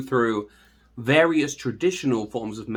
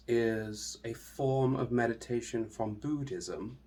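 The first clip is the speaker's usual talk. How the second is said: guided meditation; 7 dB quieter than usual. Acted. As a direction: ease the listener into stillness, hushed and unhurried.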